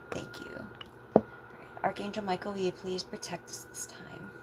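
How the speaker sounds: soft, quick prayer fading towards a whisper; murmured prayer. Real, not acted. Reader asks spirit guides for protection before the reading, hushed prayer.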